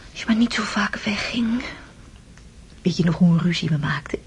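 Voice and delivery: gentle teasing whisper; hushed memory; wistful whisper. Acted. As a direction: whisper it close and fond. Wife whispers to husband.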